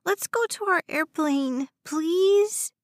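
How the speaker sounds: put-on high child's plea, rising on please; begging little boy. A narrator's reading. West, arms around his mother, begs in high childish voice. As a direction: beg Mama as a small boy.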